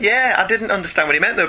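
usual speaking voice

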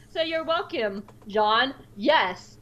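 ordinary delivery

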